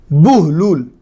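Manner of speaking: بہلول as buh-lool, short u then long oo; بہلول with the ha held silent and a clear u; correct Arabic pronunciation. Shown as a demonstration. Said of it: "اس کا صحیح تلفظ بہلول ہے".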